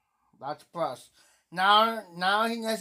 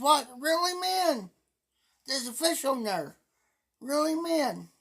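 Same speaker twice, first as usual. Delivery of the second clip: put-on child voice; 7 semitones higher than usual. Quoting granddaughter Emma's reply.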